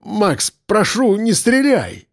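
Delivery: high, drawn-out begging; pleading. A narrator's reading. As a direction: implore him for mercy, slowing on the plea. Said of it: "взмолился он".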